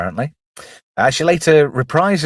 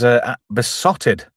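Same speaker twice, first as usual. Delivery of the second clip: normal talking voice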